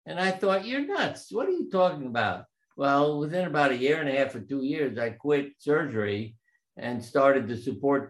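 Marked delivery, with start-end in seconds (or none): 0.7-2.4 incredulous retort. Retold or imitated. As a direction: scoff at an absurd claim, voice pitched up. Retold thought answering partner's claim.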